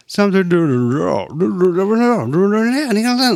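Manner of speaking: garbled, swooping nonsense sounds; loud wobbly broken-tape imitation; imitating a messed-up recording. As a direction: mimic a glitching, warped playback. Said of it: "like a fucked up recording".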